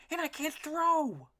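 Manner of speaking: high, slow little duckling voice. A narrator's reading. Elmer voiced high in story.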